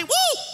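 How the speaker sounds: high, playful "woo"; self-admiring whoop. Acted. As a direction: whoop as if thrilled with yourself. Acting out admiring himself.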